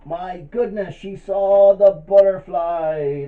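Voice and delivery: high, loud, drawn-out astonishment; amazed reveal. Pretend. Storyteller telling children what she saw, wildly amazed. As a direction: build to a huge slow reveal.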